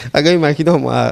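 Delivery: ordinary talk, nothing marked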